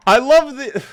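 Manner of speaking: high, loud startled cry; startled yelp. Real, not acted. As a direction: jolt up as the zombie appears. Startled by zombie at door.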